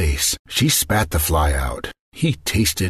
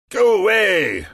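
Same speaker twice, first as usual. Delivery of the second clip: magician yelling; 13 semitones higher than usual. Acted. Magician yelling at the queen to leave. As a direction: bark him off from bed. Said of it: "the magician yelled".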